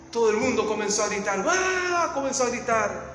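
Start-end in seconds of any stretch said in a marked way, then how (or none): 1.5-2.1 high mock cheer of the crowd; a quick high crowd cry; crowd scream. Preacher imitates the crowd cheering the walker, mock high cry.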